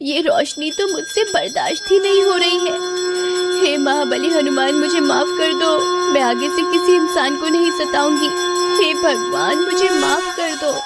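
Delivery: high, pleading witch cries; agonised wail. Acted. Witch burned by holy light begs Hanuman, agonised wail. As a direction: wail and beg for mercy. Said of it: "चुड़ैल उस रोशनी को बर्दाश्त नहीं कर पाती".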